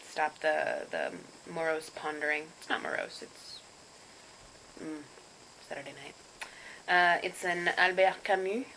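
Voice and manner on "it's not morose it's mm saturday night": near-whispered trailing aside; quiet aside. Real, not acted. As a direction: drop to a whisper. Self-deprecating aside.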